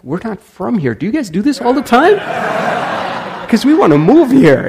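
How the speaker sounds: high, loud and delighted, laughing in between; excited tourists. Retold or imitated. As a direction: gush like thrilled visitors. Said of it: "she was so excited".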